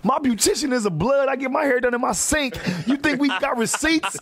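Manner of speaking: very high indignant woman voice; hammed-up furious high rant; mock angry Tyree. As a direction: squeal the rant in high outrage. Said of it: "She said".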